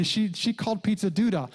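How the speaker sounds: normal talking voice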